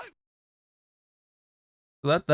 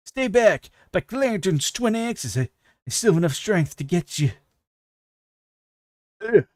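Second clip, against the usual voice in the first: wounded dwarf warrior; 7 semitones higher than usual. Acted. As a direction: shout it like a cornered dwarf with axes raised. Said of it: "let me put on actually better voice".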